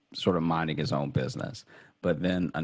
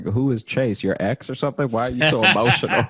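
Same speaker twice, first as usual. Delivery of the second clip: mock suspicious date; 6 semitones higher, 7 dB louder, faster than usual. Imagined date questioning him.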